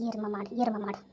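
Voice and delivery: low, soft muttered insult; grumbling under breath. Acted. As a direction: mutter the insult to yourself, sulking. Grumbling insults after being scared. (முணுமுணுத்து திட்டுவது)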